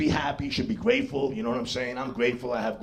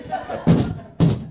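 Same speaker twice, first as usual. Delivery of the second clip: mock puffing; 4 dB louder, slower than usual. Mimicking puffing at the homeless man.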